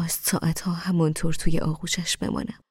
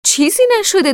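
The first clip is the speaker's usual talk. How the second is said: much higher, louder shout; 10 dB louder than usual. Acted. Amir shouting to Mohammad from afar, loud shout. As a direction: call out fast to reassure. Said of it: "امیر فوری فریاد زد".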